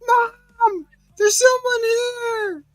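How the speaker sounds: put-on scared girl calling her mother at night; mock scared child. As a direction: whimper for mom like a frightened kid.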